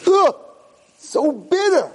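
high, loud yuck; disgusted reaction. Acted. Kid tasting old man's bitter medicine, disgusted reaction. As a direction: recoil from a vile taste.

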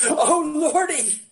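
drawn-out mock Alabama exclamation; Alabama accent. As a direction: imitate a dismayed Alabama lady, stretched out. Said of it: "an Alabama accent".

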